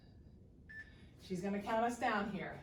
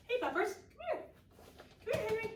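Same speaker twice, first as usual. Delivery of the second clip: high cooing dog call; puppy talk; 12 semitones higher, faster than usual. Real, not acted. Calling the puppy.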